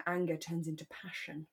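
ordinary delivery

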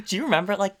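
fast, high, laughing words. Real, not acted. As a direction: laugh as you ask. Laughing while speaking.

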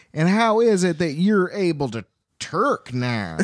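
mock Southern twang, drawn out; southern drawl. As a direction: ask it in a hammed-up southern accent. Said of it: "Dorothy gets a southern drawl".

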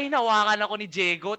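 normal talking voice

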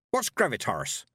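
sharp, suspicious witch's question; pointed demand to know; sharply. A narrator's reading. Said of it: "said Granny Weatherwax sharply".

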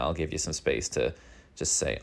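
ordinary talk

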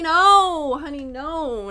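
loud, high, drawn-out "no"; big playful exclaimed no; emphatic mock-scolding no. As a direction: belt it out like a sassy friend correcting someone.

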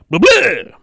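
loud, high babble after a flub; frustrated blah-blah. Real, not acted. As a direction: blurt nonsense high and loud. Mocking own misreading.

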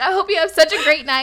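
loud, high well-wishing with laughter near; effusive imitation. Drunk guy wishing her well, loud effusive imitation. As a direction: gush it like a happy drunk.

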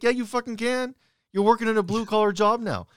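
high, emphatic comeback; insistent retort. Retold or imitated. Son contradicting his mom about work talk, insistent. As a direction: push back hard, pitch jumping way up. Retells arguing back at mom.

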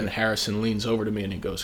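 ordinary talk

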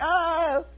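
high mock yell of alarm; acted cry. The guy struck by the prophet's sign, acted cry.

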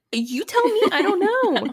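high, rattled comeback; exasperated retort. Retold or imitated. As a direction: throw the question right back. Retelling her rattled retort.

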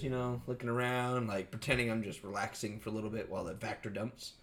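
normal talking voice

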